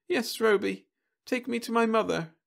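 put-on high pleading child; a lost small girl; little girl voice.

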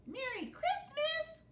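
very high squeaky mouse call. Acted. The mouse greets the captain, high squeaky call. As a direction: sing it out tiny and bright. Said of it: "called the mouse".

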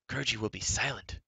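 a little beast vowing silence; creature's cry. A narrator's reading. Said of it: "Gurgi cried".

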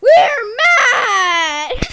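loud, crying yell; mad shout. Acted. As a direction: yell it with a cry. Mad chant.

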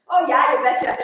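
folksy Minnesotan agreement; Minnesotan accent. Acted. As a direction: play a true Minnesotan. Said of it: "My dad's a true Minnesotan".

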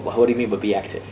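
ordinary talk, nothing marked

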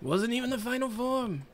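Trump impression. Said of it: "And he was doing like".

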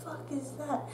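under your breath, alarmed; hushed shock. Retold or imitated. Student feeling empty tooth space mid-exam, hushed. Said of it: "I'm like".

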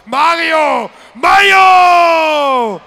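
long, soaring, high ring-announcer yell; drawn-out announcer shout. Acted. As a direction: stretch the name out and roar it. Announcing fighter's name in the ring.

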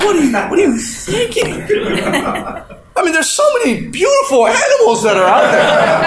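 high, laughing mock panic; horrified husband. Husband refusing the flea-covered dog, high mock panic. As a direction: squeal in mock horror at the idea.

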